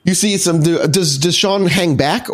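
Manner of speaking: usual speaking voice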